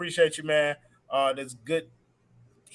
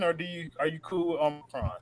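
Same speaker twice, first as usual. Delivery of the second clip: ordinary talk, nothing marked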